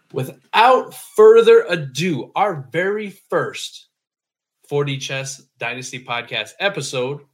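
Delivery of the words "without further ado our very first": hyped announcer. Acted. Hyped drawn-out intro.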